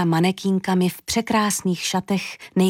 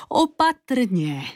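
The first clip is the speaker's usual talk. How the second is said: slow, drawn-out higher wail; wailing plea; 4 semitones higher, much slower than usual. A narrator's reading. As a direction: moan it out in alarm. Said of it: "zaúpěla paní Hana".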